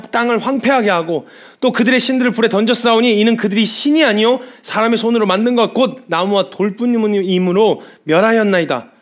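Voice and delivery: loud, high-pitched fervent plea; Hezekiah's urgent prayer. Acted. Preacher acts Hezekiah begging God to rescue his people, fervent prayer. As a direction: pray out loud with full force. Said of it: "히스기야가 기도를 하기 시작합니다".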